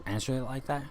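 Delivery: usual speaking voice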